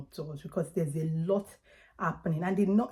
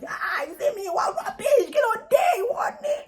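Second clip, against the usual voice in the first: high shrieking Yoruba woman scolding; Yoruba accent; 5 dB louder than usual. Retold or imitated. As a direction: screech it like a furious Yoruba wife. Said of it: "you to your partner, say".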